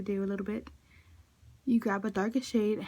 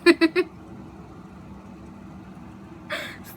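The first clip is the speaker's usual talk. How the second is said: long breathy giggle fit; can't stop giggling; laughing; 7 semitones higher than usual. Real, not acted. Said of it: "I can't stop laughing".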